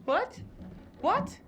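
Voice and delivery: high startled cry; shocked, rising what. Acted. Startled by the alarm.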